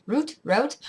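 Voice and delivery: "root" with oo, then "route" rhyming with out; "root" like boot, "route" like out. Shown as a demonstration. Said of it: "I think it's actually pronounced route in Canada".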